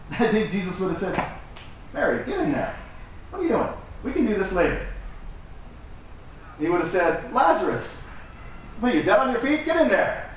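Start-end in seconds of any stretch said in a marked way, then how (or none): 1.9-4.8 higher-pitched acted nudge to get moving; lightly exasperated, pitched up; mock urging. Acted Jesus sending Mary to help. 7.3-10.3 high-pitched, incredulous prodding; playful exasperation, pitch jumping up; mock chiding. Acted Jesus chiding Lazarus.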